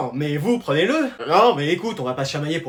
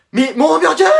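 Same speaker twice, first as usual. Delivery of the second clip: high, raised-voice protest; outraged high-pitched question; indignant disbelief; 9 semitones higher, 4 dB louder than usual. Acted. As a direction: cry out as if robbed. Protests a taken hamburger.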